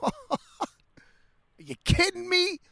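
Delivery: high, disbelieving cry; are you serious, real outrage; incredulous. Real, not acted. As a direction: pitch it way up in disbelief. Disbelief at the tank.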